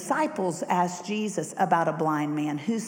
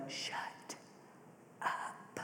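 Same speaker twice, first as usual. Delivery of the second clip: much softer, a voiceless threatening hiss; threatening hush; 17 dB quieter than usual. Acted. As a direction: hiss it to silence him. Religious crowd silencing Bart.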